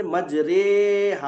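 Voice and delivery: मजरेहा drawn out slowly with a long "ray"; imala with thin r. Shown as a demonstration. Teacher giving the imala example, मजरेहा slow and thin. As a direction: stretch मजरेहा, light r and ay vowel. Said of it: "इमाला की हालत में भी रॉ बारीक".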